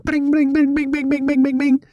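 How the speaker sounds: high sing-song bing bing of a ringing phone; imitated phone ring. Phone ringing him awake at night, high sing-song bings.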